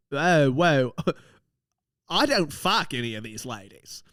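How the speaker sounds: protesting Aussie bloke impression; hammed-up Australian accent. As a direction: imitate an Australian mate defending himself. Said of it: "I'm trying to do an Australian accent".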